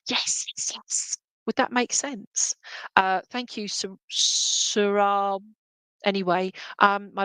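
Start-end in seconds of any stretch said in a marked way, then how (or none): 0.1-1.2 soft whispered yes, yes, yes. Retold or imitated. Medium voicing a spirit's quiet agreement, in a whisper. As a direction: breathe the yeses like a secret. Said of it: "you're just saying".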